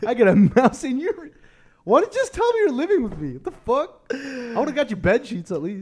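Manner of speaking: high-pitched hurt disbelief; hammed-up indignation, voice way up; mock shock. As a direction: act astonished at a secret housemate. Plays shock at secret housemate.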